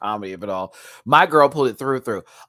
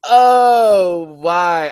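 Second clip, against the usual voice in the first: loud, high wailed lament; crying; 5 semitones higher, 13 dB louder than usual. Real, not acted. Host moved by Viv's tip, loud tearful wail. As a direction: wail it out, overcome. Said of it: "I'm gonna cry again".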